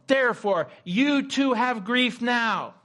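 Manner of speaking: slow, loud, high proclamation; retold triumphant scripture shouted down the phone; almost shouting. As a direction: declare each phrase forcefully, stretched out. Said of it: "she almost shouted it at me in the phone".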